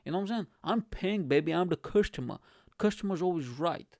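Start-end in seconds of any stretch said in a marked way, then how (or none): none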